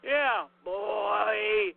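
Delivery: normal talking voice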